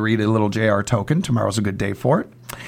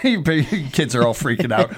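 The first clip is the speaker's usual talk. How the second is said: ordinary talk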